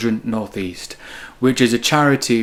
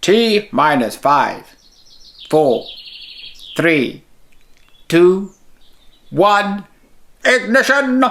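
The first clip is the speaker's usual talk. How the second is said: building countdown; 7 semitones higher, 3 dB louder, slower than usual. Acted. Countdown before rocket launch.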